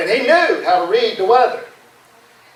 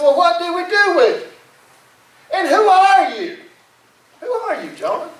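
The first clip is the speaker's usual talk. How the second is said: acted exasperated interrogation, pitched way up; agitated questioning; 10 semitones higher than usual. Crew confronting Jonah over the storm, agitated questioning.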